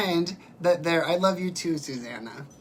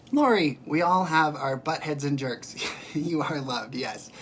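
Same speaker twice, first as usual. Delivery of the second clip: ordinary delivery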